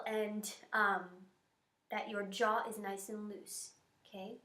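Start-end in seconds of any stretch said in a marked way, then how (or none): none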